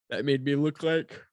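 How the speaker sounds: words stretched through a yawn. Real, not acted. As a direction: yawn mid-sentence. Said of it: "that's me yawning twice now".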